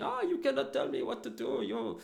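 high-pitched imitation of a driver's angry rant; hammed-up high, indignant tirade; losing his shit. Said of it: "he loses his shit at me".

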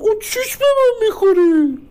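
very high, loud, slow drawl; hammed-up squeal of a scornful girl; mocking girl's voice. Girl turning down a suitor to the messenger, mock high-pitched drawl.

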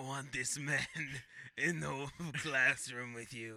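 slow sailor impression breaking into laughter; put-on seaman voice, halting; Quint. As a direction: try to stay an old sailor while laughing. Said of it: "you keep talking like that".

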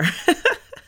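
short high laugh; bright laugh. Real, not acted. Laughs at own joke.

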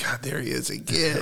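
mock weary cops. Cops spotting their frequent flyer again, mock weary. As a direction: lightly imitate officers fed up with a regular.

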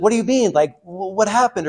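high, alarmed mother's cry of what do you mean; panicked mom. Retold or imitated. Mother hearing bad news from her son on the phone, panicked and high.

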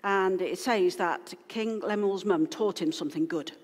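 usual speaking voice

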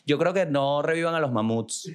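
ordinary talk

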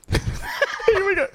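squeaky high giggling through the words, slow; barely gets it out for laughing. Real, not acted. Laughing at own sloth reading.

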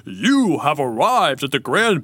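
grand, slow swooping proclamation; eloquent lion. Acted. Lion welcoming visitor to wizard's palace, pompously eloquent. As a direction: announce it with mock grandeur. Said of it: "a little more eloquent".

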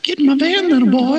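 high sleazy creep; creepy stranger voice. Acted. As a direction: lure him in like a creep.

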